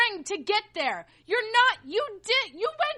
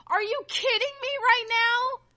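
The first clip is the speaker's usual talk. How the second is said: usual speaking voice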